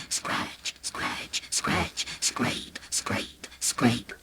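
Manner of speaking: breathy whispered scratch sounds. A narrator's reading. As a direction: whisper the scraping noises. Scratching sound effect words.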